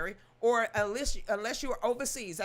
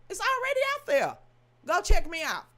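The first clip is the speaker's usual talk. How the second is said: much higher and louder, near shouting; exasperated outburst; 8 semitones higher, 6 dB louder than usual. Real, not acted. Vlogger shouting at privacy worriers, exasperated. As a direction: blast it back at them.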